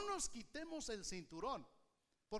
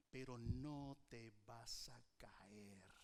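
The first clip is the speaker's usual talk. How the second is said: unvoiced, much lower whisper; hushed divine reassurance; whispering God; 13 semitones lower, 7 dB quieter, slower than usual. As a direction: breathe it as God's promise. God's reassuring quote.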